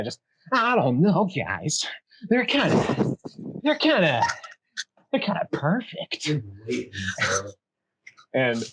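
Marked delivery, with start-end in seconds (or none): 0.5-6.3 high, jittery nervous elf; twitchy elf. Acted. Twitchy elf sizing up the party, jittery high. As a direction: stutter it up high. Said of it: "elf that's kinda got this twitch".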